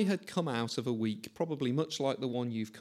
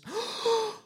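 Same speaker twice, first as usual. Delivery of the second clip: sharp gasp. Acted. Sudden realisation reading verse.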